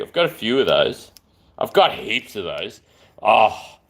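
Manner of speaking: normal talking voice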